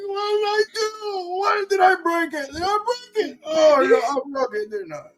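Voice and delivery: very high, voice cracking with tears; panicked little creature. Acted. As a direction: squeak it out, near tears and bewildered. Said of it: "he just goes".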